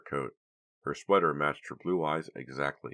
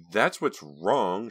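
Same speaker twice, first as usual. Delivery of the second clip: slow, louder words through clenched teeth; 6 semitones higher, 7 dB louder, much slower than usual. A narrator's reading. Karina shows Vincent the tissue, teeth clenched. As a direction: grind it out in anger. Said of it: "she uttered through clenched teeth".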